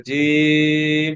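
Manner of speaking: জিম drawn out very slowly, vowel held long; very long jim. Shown as a demonstration. Teacher to class, stretching জিম very long. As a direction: hold জিম like a chanted note. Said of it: "অনেক লম্বা করে".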